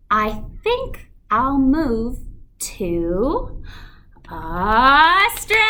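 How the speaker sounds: crescendo then decrescendo. Shown as a demonstration. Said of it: "whenever we have this rhythm and this word".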